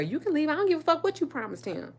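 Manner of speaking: high, loud and fast dismissive comeback; loud high fast defiance; defiant retort. Retold or imitated. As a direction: fire it back high and quick, daring him. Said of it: "You know how we are".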